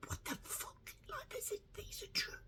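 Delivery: breath-only disbelief; astonished whisper. Retold or imitated. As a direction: whisper it as if stunned by the find. Acts out astonished reaction to reading.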